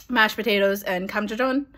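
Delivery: ordinary talk, nothing marked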